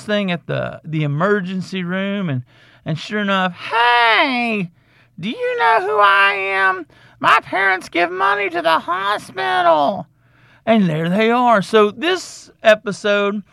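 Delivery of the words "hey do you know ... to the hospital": high, whiny spoiled patient; mock a kid boasting about parents; squeaky, high show-off; entitled brat voice.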